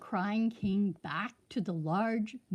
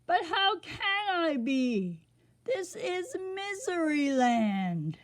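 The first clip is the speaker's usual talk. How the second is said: crying king; 8 semitones higher than usual. A narrator's reading. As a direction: cry out in despair. King's voice.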